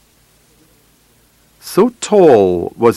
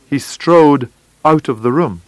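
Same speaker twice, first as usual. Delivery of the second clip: ordinary delivery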